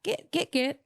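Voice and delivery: a light hint of a stern British nanny; bossy nanny. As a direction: shoo the kids off to bed. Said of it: "a mom or a nanny being like".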